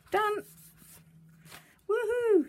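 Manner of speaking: high, loud triumphant whoop; excited cheer. Real, not acted. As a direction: celebrate finishing. Finishing a fiddly step.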